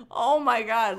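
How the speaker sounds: ordinary talk, nothing marked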